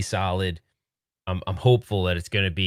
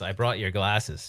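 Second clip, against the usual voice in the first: ordinary delivery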